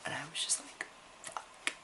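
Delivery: barely audible whispered curse; whispered dismay. Retold or imitated. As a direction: whisper it as the mistake sinks in. Acting out dismayed realization.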